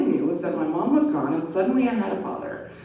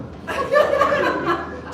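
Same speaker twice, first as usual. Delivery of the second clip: bright burst of laughter, much higher; real laugh; 4 dB louder than usual. Real, not acted. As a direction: laugh out loud, amused. Reader laughs at the text.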